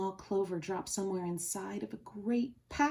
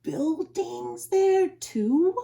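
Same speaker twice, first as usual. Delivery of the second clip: very slow, higher, awed question; drawn-out astonished wonder; amazed; 8 semitones higher, 6 dB louder, much slower than usual. Acted. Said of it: "Horton gasped".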